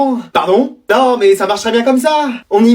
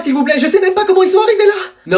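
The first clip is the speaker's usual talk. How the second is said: pleading; 9 semitones higher than usual. Acted. Pupil begs principal not to punish.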